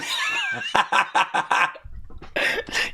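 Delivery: squeaky high laugh; high giggle. Real, not acted. Laughing at his own misreading, high giggle. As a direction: burst into a giggle.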